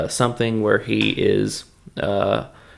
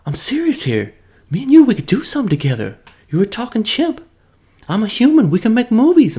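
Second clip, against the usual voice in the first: much higher, eager mock pitch to a chimp; Mark Wahlberg impression; 10 semitones higher than usual. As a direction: pitch the ape a movie deal in a goofy high voice. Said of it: "and he's like".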